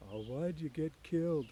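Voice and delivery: a big simple man grieving gently over the puppy; lightly voiced Lenny, sorrowful; Lenny softly. A narrator's reading. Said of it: "Lenny said softly to the puppy".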